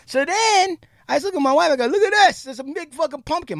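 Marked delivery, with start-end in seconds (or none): 1.9-3.6 high-pitched excited boast; retold proud showing-off. Husband showing wife his carved pumpkin, high and excited. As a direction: show it off in a high, eager voice.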